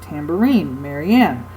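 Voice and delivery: Tambourine, Marianne, each accented on its last syllable; two light syllables, then land on the third in Tambourine, Marianne; anapestic stress. Shown as a demonstration. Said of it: "anapestic is two unaccented".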